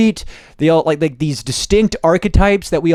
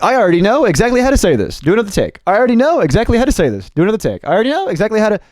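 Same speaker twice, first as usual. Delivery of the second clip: fast, higher, repeated identically each take; rote, memorized line; 5 semitones higher, much faster than usual. Shown as a demonstration. Actor repeating takes for a director, rote delivery. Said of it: "don't come in here with lines memorized".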